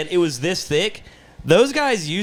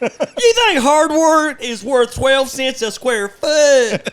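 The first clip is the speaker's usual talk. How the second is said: higher, louder, slow outrage; mock offended seller; 10 semitones higher, 4 dB louder, much slower than usual. Seller scolding a Marketplace lowballer with slow, higher mock outrage. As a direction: play an insulted seller being lowballed.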